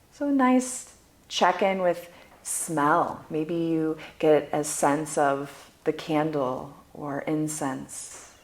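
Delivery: soft, unhurried meditation voice with long pauses; guided meditation. Acted. Guide leading listeners through sound, smell and touch, guided meditation. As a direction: guide them gently through each sense, letting phrases fade.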